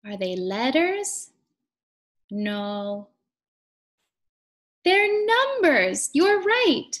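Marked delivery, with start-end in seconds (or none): 4.8-6.9 high, loud delighted confirmation; excited praise. Acted. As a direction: cheer them on for getting it right. Confirming children's answer with delight.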